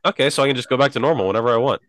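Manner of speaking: quick, bright mock British accent. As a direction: rattle it off in a put-on British accent. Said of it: "I think I would do a British accent".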